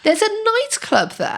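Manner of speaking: high, disbelieving question; genuinely can't believe it; surprised. Real, not acted. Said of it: "Oh, yeah".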